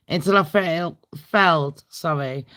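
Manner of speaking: ordinary talk, nothing marked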